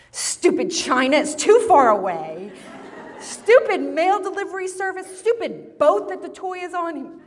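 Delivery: put-on raging, crying child. Son raging over his late toy, acted tantrum. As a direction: imitate a kid raging at the world, hammed-up. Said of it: "He's so angry, he rages. He starts crying, he says".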